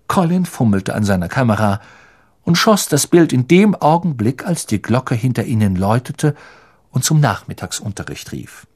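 plain reading voice

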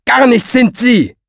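loud, high, exasperated; irritated outburst; sharp interruption. A narrator's reading. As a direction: cut in to quash the nonsense. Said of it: "fuhr Fenoglio dazwischen".

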